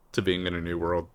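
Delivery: usual speaking voice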